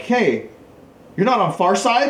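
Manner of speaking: mock suspicious question. New Yorkers question him, retold.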